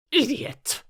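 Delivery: high, scornful outburst; impatient Queen. A narrator's reading. Queen scolding the Knave, impatient high voice. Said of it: "tossing her head impatiently".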